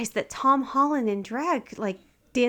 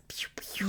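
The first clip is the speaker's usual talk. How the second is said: laser gun noise; 11 dB quieter than usual. Pretend. Mimicking laser gun sounds.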